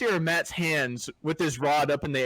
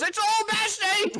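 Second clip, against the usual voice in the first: excited yell; 4 dB louder than usual. Retold or imitated. Friend yelling about hooked fish.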